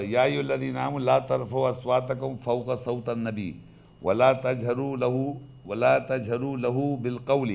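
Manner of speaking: slow, even chanted verse; Quran recitation. Acted. Lecturer recites the verse on raising voices. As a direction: recite the verse with measured care.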